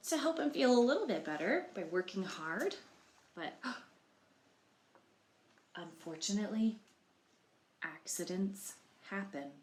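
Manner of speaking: plain reading voice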